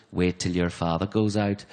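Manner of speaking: low, slow Northern Irish menace; Northern Irish accent. Retold or imitated. Wife warning naughty kids, menacing Northern Irish accent. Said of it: "I love the Northern Irish accent".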